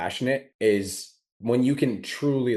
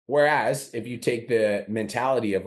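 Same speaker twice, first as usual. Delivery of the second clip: ordinary delivery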